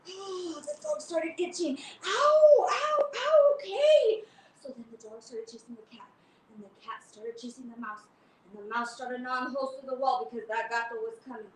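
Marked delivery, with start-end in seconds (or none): 2.0-4.2 high, loud yelps; dog yelping from the bite. Acted. Dog bitten by flea. 4.6-8.6 hushed storytelling; whispered buildup; whispered narration. Acted.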